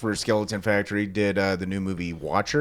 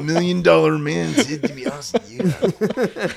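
loud, high wrestler promo growl; Macho Man; 7 semitones higher, 7 dB louder than usual. Acted. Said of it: "The Macho Man".

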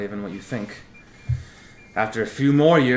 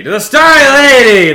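higher, much louder raised toast; toast shout; 16 dB louder than usual. Acted. Fisher toasts the Starry Lady, shouting to the tables. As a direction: call it out to the whole tavern.

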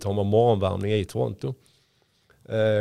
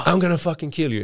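retold angry scream; 6 semitones higher than usual. Opponent screaming on the way out, higher mock yell. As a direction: imitate a raging threat without shouting fully. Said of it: "Och han skriker bara".

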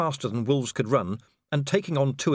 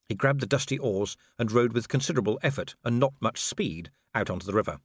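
usual speaking voice

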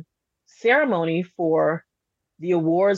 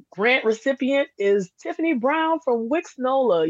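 raised-pitch retold winner announcement; award announcer; 7 semitones higher than usual. Imitates presenter announcing the winner.

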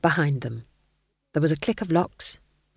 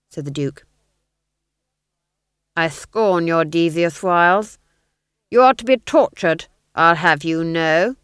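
pompous duke; 7 dB louder than usual. Acted. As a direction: declaim like a ham villain. Duke's pompous threats.

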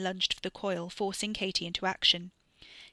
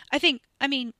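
flustered; 6 semitones higher, 7 dB louder than usual. A narrator's reading. Flustered Katie answers Wes.

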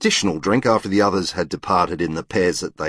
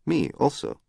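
put-on higher-pitched French-accented English; 7 semitones higher, 4 dB quieter than usual. A narrator's reading. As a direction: lilt it like a Frenchwoman speaking English. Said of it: "in an attractive French accent".

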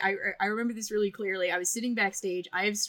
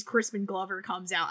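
normal talking voice

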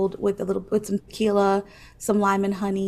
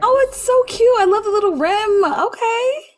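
very high, loud delighted squeal of approval; excited gushing; 12 semitones higher, 6 dB louder than usual. Real, not acted. Co-host admiring her friend's martini glass, excited gushing. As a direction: gush over it, pitched way up and loud. Gushing over co-host's drink.

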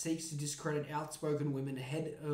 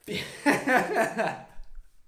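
laugh; 4 semitones higher, 5 dB louder than usual. Real, not acted. Laughs at own rant.

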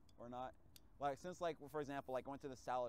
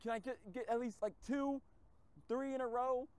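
high, loud exasperated rant at traffic lights; mad rant; 10 semitones higher, 6 dB louder than usual. Retold or imitated. Driver ranting at endless red lights, high loud exasperation. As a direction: vent at the red lights, pitched way up. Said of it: "I've been so mad".

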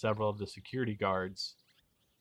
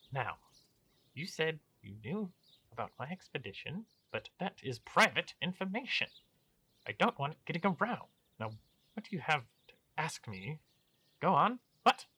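pompous baron voice; 7 semitones higher, 3 dB louder than usual. Acted.

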